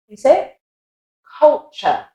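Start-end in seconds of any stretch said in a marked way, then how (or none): 1.4-2.1 British Culture ending in "chuh"; British accent. Shown as a demonstration. Teacher repeating Culture for learners, British accent. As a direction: Culture split evenly, no R, British. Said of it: "And then an American accent".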